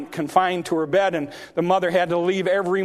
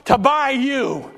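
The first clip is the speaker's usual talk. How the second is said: high, loud, slowing preacher's cry; preaching at full force; 8 semitones higher, 4 dB louder than usual. Acted. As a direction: build the altar call to a shout. Preacher building to a climax.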